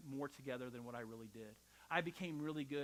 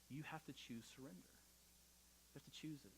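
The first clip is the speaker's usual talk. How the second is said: hushed emphasis; 3 semitones lower, 14 dB quieter, slower than usual. Real, not acted. Preacher urging the congregation to choose surrender, near whisper.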